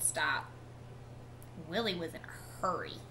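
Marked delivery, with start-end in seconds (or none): none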